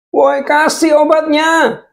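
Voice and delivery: high, slow, loud plea for medicine; anguished high shout; desperate call. Acted. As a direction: cry out for the medicine. Dying man calling for medicine.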